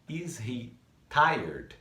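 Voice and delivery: "Is he tired?" stretched out word by word; very slow model question. Shown as a demonstration. Teacher repeating the question very slowly for students. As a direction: drag it out so they can copy. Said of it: "nós temos is he tired?".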